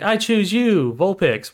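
high, hammed-up trainer's cry; heroic battle call. Trainer sending Vulpix into battle, mock heroic. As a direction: call out your creature like a cartoon hero. Acts out Ash throwing a brick.

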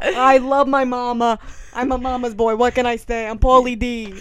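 loud, bragging mock Jersey Italian. Mocking a proud mama's boy, loud Jersey Italian accent. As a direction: proclaim it in a hammed-up Jersey accent. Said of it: "they have this complex about being Italian".

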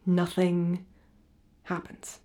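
soft, with the last word nearly voiceless; hollow resignation. Acted. Refugee to therapist about life now, resigned and fading. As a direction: let the phrase drop away.

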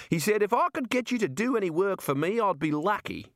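high-pitched Australian character voice; friendly Australian Bill, persuading. Acted. Bill offers Rincewind a place, high Australian voice.

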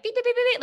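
high, loud imitated alarm beeping; alarm beeps. Warning about a dangerous guy, loud alarm beeps.

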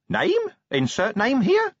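high squeaky puzzled imp. Acted. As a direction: squeak it out, baffled. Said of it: "looking puzzled".

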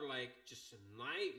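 normal talking voice

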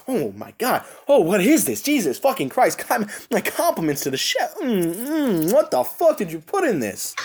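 hammed-up delight, high swooping pitch; exaggerated swooning over a dish; mock gourmet rapture. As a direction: savor it like an amazed taster. Acting out a delighted wine taster.

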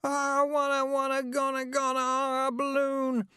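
put-on high, furious small-child wail; high, angry crying child voice; toddler wail. A narrator's reading. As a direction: wail like a toddler who lost a balloon. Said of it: "a long scream of rage".

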